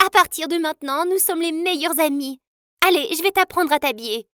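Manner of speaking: put-on a high, cheerful girl announcing friendship; bubbly new friend. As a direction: gush like a sudden best friend. Diane befriends her happily.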